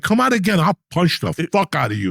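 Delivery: high-pitched mock outburst at a pet; mock threat. Retelling a threat to punch the cat, worked-up mock anger. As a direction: threaten it comically, pitch jumping up.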